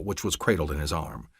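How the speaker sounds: ordinary delivery, nothing marked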